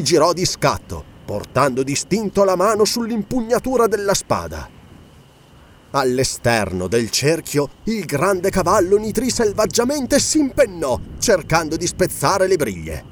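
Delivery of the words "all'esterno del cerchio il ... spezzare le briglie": ordinary delivery, nothing marked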